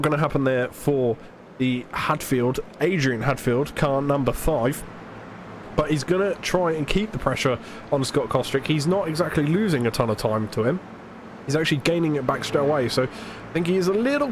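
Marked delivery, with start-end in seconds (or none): none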